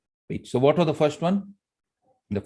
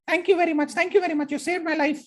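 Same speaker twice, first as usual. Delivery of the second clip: much higher, tiny squeaky voice; squeaky mouse; faster than usual. Acted. Grateful mouse thanking the lion, in a squeaky voice. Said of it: "how was my voice? Squeaky".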